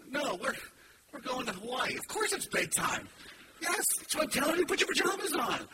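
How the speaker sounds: mock-patient sarcasm rising high; sarcastic retort. Father answering kids asking if it's bedtime, sarcastic.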